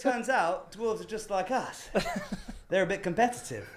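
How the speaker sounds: ordinary delivery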